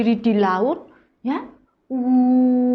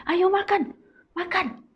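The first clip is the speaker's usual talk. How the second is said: higher coaxing urge; coaxing Paul; 11 semitones higher than usual. Acted. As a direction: coax them to eat. Paul urging them to eat. (Paulus membujuk makan)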